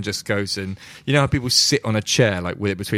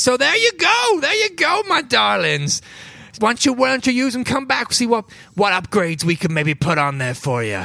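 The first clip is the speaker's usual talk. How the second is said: much higher, sing-song motherly voice; fond shopkeeper woman; 14 semitones higher than usual. Acted. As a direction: coo it to the adventurers like a doting auntie. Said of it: "and she says".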